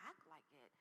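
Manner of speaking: quiet, stunned repeat of "Act like it?"; stunned echo. Retold or imitated. Echoing the boss's words back to herself, stunned and soft. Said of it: "I was just so stunned".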